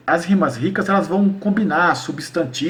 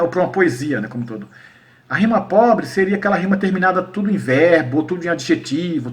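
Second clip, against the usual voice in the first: ordinary talk, nothing marked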